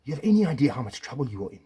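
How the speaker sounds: angry threat. Real, not acted. Husband warning his ex-wife, loud angry threat.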